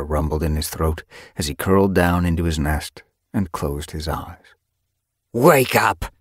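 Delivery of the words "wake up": snarl. A narrator's reading. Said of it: "A snarl wrenched him from sleep".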